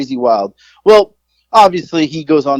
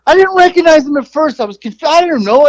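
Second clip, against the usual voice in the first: raised, rushed self-quote, higher; embarrassed self-quote, pitched up; flustered retold excuse; 12 semitones higher than usual. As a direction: tell it like you're flustered and sheepish. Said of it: "I'm like".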